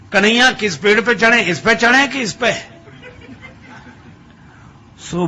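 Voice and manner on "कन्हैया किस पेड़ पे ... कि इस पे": high, loud call across the orchard; calling out loudly. Acted. Madhavdas calls loudly to Kanhaiya in the orchard, loud and high. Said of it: "जोर से बोलने लगे".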